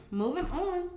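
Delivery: ordinary talk, nothing marked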